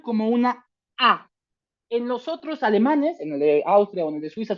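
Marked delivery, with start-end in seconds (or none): none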